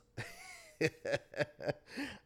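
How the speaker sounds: rising chuckle; laughing. Real, not acted. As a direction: laugh at the memory. Laughing at being drunk.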